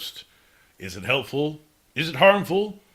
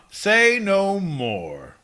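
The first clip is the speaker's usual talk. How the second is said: slow knowing; 8 semitones higher, 4 dB louder, much slower than usual. Acted. Drawn-out knowing reply.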